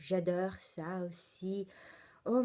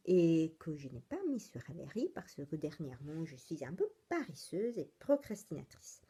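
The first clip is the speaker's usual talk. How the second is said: usual speaking voice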